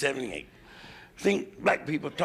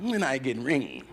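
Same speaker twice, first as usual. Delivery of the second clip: nagging wife; 3 semitones higher than usual. Retold or imitated. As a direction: whine it like the wife who won't let up. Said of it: "she asked me".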